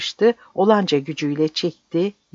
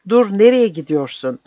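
sharp raised call to stop her; calling her back; 4 semitones higher, 6 dB louder than usual. A narrator's reading. Man calling after the woman to stop her, raised voice.

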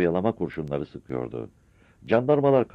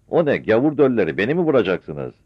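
loud defiant taunt; defiant shout; 6 semitones higher, 6 dB louder than usual. Acted. Mother defiantly taunts the shooting soldiers. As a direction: dare them to shoot.

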